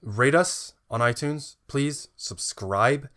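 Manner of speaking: drawn-out plea to subscribe; slow pleading. Acted. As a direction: stretch each word, begging. Begging listeners to subscribe.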